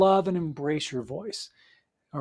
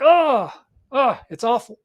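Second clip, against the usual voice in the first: hammed-up groan of dismay, higher and louder; mock disgust; 5 semitones higher, 6 dB louder than usual. Author hearing their voice played back, mock disgusted groan. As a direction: imitate an author recoiling at their own recording. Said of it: "I play it back".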